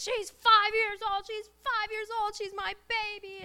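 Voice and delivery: re-enacted tearful wail, very high and drawn out; losing it. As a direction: wail it like a mother at breaking point. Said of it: "And I lost it".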